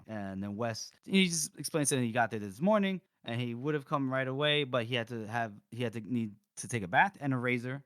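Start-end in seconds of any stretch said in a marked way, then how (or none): none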